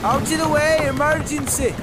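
shouting. Acted. Rescue shouts on stormy dock.

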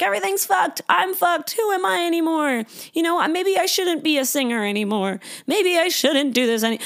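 high, dramatic doom spiral; mock despair. Catastrophizing imagined self-talk.

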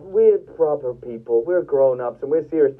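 low, measured air of superiority; mock self-important. Politicians claiming to be above it all, mock self-important. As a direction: send up people who think themselves serious grown-ups. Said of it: "they pretend that they're sort of above all that stuff".

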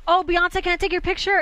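mock fan excitement. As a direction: gush like a certain, star-struck fan. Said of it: "They're not saying".

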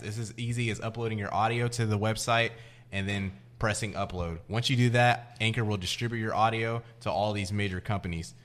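upbeat podcast sponsor read. Acted. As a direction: read it like a radio ad. Reads an ad read.

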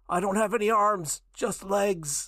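put-on high, silly old man voice. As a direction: quote Ash's fake old man. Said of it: "Ash when he's pretending to be the, uh, old man".

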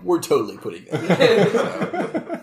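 slow, high, drawn-out declaration; mock announcement. Host staging his line for the edit, mock announcement. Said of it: "edit it out to me saying".

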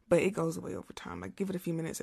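ordinary talk, nothing marked

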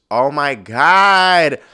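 slow, loud, stretched-out exclamation; drawn-out oh my God. Real, not acted. Laughing at her own raunchy joke, a loud drawn-out oh my God. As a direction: let it out long and loud.

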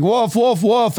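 high-pitched mock cry of wolf; retold mock scream. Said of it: "he started screaming".

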